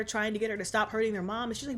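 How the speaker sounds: ordinary talk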